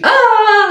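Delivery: high, loud "ah" of pain; short hammed-up shriek; mock pained scream. Girl getting a painful blow dry, mock scream.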